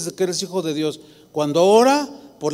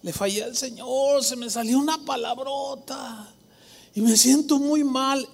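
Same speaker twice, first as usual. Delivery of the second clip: a touch of a remorseful, teary voice; tearful believer; 6 semitones higher than usual. Retold or imitated. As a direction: imitate a churchgoer near tears over a slip. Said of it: "y empiezan a llorar".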